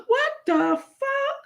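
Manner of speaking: put-on very high, slow, wailing old-lady what-the-fuck; Grandma Holla impression. Grandma reacting to someone doing something crazy, high slow wail. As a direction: stretch it out like a shocked grandma. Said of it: "She always saying when somebody do something crazy".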